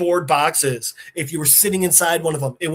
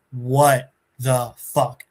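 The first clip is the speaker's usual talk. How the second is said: slow, low, word by word; stunned disbelief; 5 semitones lower, much slower than usual. Retold or imitated. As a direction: space the words out in dazed deadpan. Stunned after the explosion.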